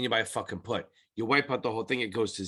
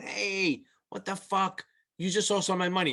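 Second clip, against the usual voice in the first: mock irate customer; 7 semitones higher than usual. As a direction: act out an upset client, pitched up. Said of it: "screaming at you".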